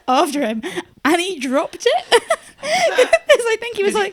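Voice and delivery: telling it through high, loud laughter; laughing. Real, not acted. Telling friends how the snatcher dropped it, laughing. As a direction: crack up while getting the words out.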